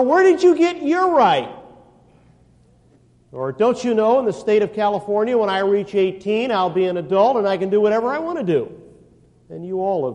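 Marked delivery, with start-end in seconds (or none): 0.0-1.5 high, loud mock teen whine; mocking a teenager's challenge; whiny teenager. Mimics teenager. 3.5-8.7 put-on raised, louder bratty teen voice; parroting a teenager who argues; mock teen. Mimics teenager.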